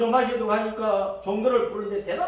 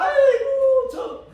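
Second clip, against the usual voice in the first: mocking taunt; 5 semitones higher, 5 dB louder than usual. Village kids taunting Job.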